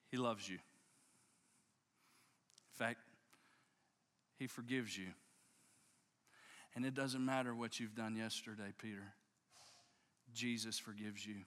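low, softened, with long pauses; tender reassurance. Acted. Angel's message of forgiveness to a fallen Peter, low and tender. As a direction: let each assurance land gently, voice dropped.

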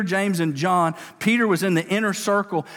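ordinary talk

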